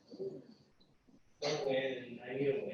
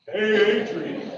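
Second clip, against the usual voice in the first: slow, loud Rocky Balboa voice; drawn-out boxer voice; Rocky impression; 3 semitones higher, 12 dB louder, slower than usual. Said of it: "So Rocky the raccoon".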